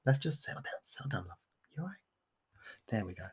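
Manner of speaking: calm someone down in a pretend-tender murmur; playfully comforting, barely audible; mock soothing. Mock-soothing the confused co-host.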